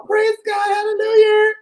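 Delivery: high falsetto praise, mock church lady; high churchy praise. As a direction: squeal it like an overjoyed church lady.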